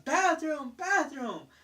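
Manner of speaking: mock kid yell. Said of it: "He was just yelling".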